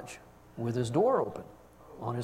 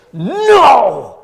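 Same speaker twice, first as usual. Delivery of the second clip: put-on little boy yelling no through gritted teeth, much louder and higher; child's angry no; 9 semitones higher, 19 dB louder than usual. Grandson snaps at grandfather checking his leg, furious shout. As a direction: shout it like an upset grandson refusing help. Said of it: "and he says".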